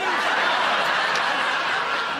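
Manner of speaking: a loud, breathless wheeze of a laugh; wheezing cackle. Real, not acted. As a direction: cackle hard at your own joke. [name] laughs at his own joke.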